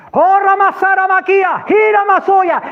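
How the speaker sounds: high-pitched full-lunged war cry; praying in tongues. Retold or imitated. Man praying in tongues against the mountains, shouted. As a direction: cry out drawn-out tongues like battle commands. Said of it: "orando en lengua".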